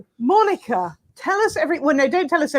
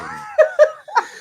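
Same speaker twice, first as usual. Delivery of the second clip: high laugh. Real, not acted. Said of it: "crap idea".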